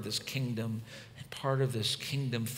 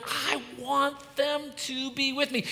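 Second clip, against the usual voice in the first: voicing Jesus; 13 semitones higher than usual. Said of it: "here's Jesus going".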